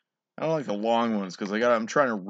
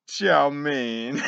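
laughing; 11 semitones higher, 8 dB louder than usual. Real, not acted. Punchline then big laugh.

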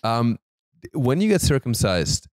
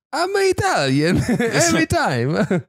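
put-on Italian accent; 12 semitones higher, 3 dB louder, slower than usual. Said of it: "I just gotta be Italian".

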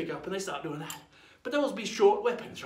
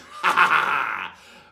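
loud falling guffaw; pirate laugh; 4 semitones lower, 11 dB louder than usual. Acted. Pirate signs off to young listeners, hearty guffaw. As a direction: give a big rolling pirate laugh.

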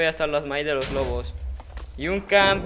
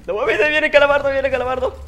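much higher and louder; frantic shriek; 11 semitones higher, 8 dB louder, faster than usual. Real, not acted. Gamer seeing Calamardo coming, frantic shriek. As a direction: shriek in alarm.

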